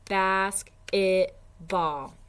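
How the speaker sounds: Basketball slowly, loud and split into three beats; clapping syllables. Shown as a demonstration. Teacher leading the clapping for parents, slow and loud. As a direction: clap out Basketball, one beat per syllable. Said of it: "Let's practice clapping it".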